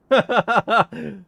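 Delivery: a loud burst of laughter; laughing. Real, not acted. Laughing at his own jail joke, loud burst. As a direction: crack up at your own joke.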